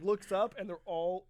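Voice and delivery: usual speaking voice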